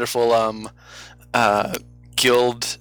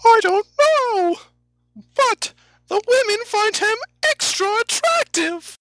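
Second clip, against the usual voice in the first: very high squeaky falsetto; cartoonish herald proclaiming in falsetto; guild crier. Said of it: "Guild Crier enters".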